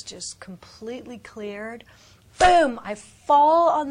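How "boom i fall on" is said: loud burst of excitement; a loud burst, telling of being overcome; excited exclaim. Real, not acted. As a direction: blurt it out with a sudden shout. Dramatic falling moment.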